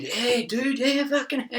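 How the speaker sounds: high, cheesy stoner-buddy voice; high mock surfer dude; mock Jesus buddy. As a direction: ham up the friendly saviour bro. Mocking everyone's-friend Jesus type.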